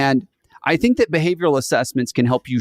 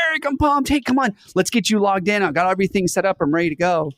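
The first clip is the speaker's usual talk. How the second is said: much higher, fired-up welcome that calms toward the end; pumped manager; 6 semitones higher than usual. Retold or imitated. Manager welcoming the new hire, pumped and higher. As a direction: greet the new hire like you can't wait. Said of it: "your manager walks out and they're like".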